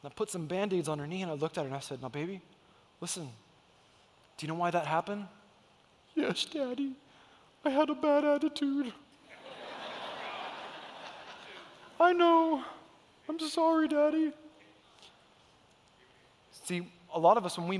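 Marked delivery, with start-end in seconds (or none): 2.0-5.3 much softer, tender talk; gentle dad. Retold or imitated. Dad talking to his daughter after the bandages, soft and gentle. 6.2-14.3 put-on high, small child's voice; little girl's apology. Dad voicing his daughter saying sorry, high childlike voice.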